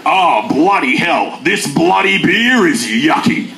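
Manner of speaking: slow gruff blokey voice; a burly guy saying yucky, slowly; tough bloke voice. Pretend. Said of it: "he can never get away with the word yucky".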